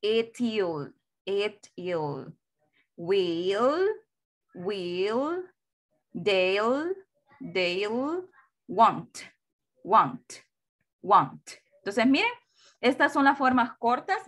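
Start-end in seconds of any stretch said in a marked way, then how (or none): 0.0-2.3 "it'll" in two falling syllables, et-iol; slow two-beat "it'll" that falls away; modelo de pronunciación. Shown as a demonstration. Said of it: "esta se pronuncia". 3.0-8.3 "will" and "they'll" stretched into rising single syllables; modelo de pronunciación. Shown as a demonstration. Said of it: "esta se pronuncia". 8.7-11.4 "want" as one short falling syllable, won't; modelo de pronunciación. Shown as a demonstration. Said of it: "esta se pronuncia".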